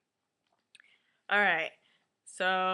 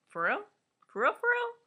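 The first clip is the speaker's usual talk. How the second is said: incredulous mimicry; 6 dB louder, faster than usual. Recapper as Asha hearing the news, squeaky mock disbelief. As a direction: voice shooting up high in mock disbelief.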